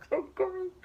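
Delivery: laughing. Real, not acted. Still laughing.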